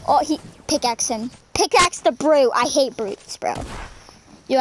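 ordinary talk

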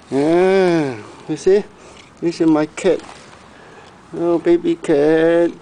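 high, doting pet talk; cooing at the cat. Real, not acted. Owner greeting his cat again, high cooing voice. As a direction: coo over a beloved cat.

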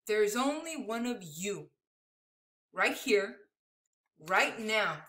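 tender storybook ending. Acted. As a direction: reveal it gently, phrase by phrase. Reveals book's closing secret.

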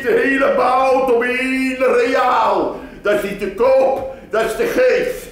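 hawking butcher. Acted. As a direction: shout the bargain to the crowd. Meat seller shouting offer. (luid roepende slager)

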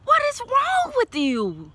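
high, slow, disbelieving scold; exasperated. Real, not acted. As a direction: throw your hands up at Leo. Reader scolds Leo over cards.

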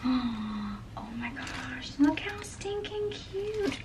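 gasp, then a very high, soft, drawn-out squeal; hushed gushing squeal. Real, not acted. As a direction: coo slowly in a tiny high voice over something adorable. Gushing over newly delivered samples.

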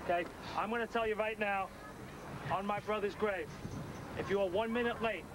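low, deliberate ultimatum; stern warning. Real, not acted. Coordinator warning the team about lateness, stern. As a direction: lay down the law, voice held low.

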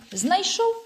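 high, expectant questioning; impatient prodding. A narrator's reading. Boy asking cross friend for news, impatient. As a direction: demand news at once.

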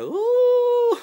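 very high, loud swooning "ooh"; gushing squeal. Retold or imitated. Vlogger gushing over his northern accent, high squeal. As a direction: squeal over how cute he is. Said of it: "afterwards I'm all like".

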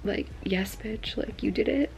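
hushed, emotional whisper, close to tears. Real, not acted. Whispering to viewers about moving west, emotional. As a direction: confide a dream in a near-whisper.

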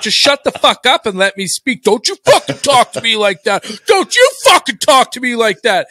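high, loud mock fury, building; retold angry shouting. Arguing back at co-host on call, retold angry shouting.

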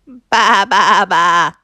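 loud, wobbling sheep bleats; bleating baas. A narrator's reading. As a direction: bleat three times like a sheep. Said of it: "said the fat red hen".

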